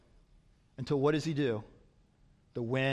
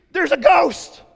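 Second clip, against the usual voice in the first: disciples shrieking in fright, much higher and louder; shrieked; 14 dB louder, slower than usual. Retold or imitated. Preacher voicing the disciples who see a ghost, terrified shriek. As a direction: scream it out like terrified fishermen. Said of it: "they shrieked".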